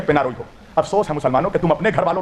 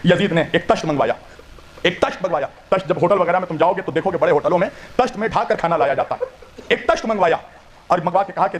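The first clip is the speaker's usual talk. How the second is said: ordinary delivery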